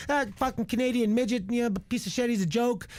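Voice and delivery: high-pitched imitation of a badmouther; mocking put-down. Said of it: "He's been shitting on me".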